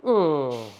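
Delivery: low flat dismissive hmm; a bored listener's verdict on your script; unimpressed hmm. Retold or imitated. Mimicking a producer's bored hmm at a narration. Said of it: "सामने से आ जाता है".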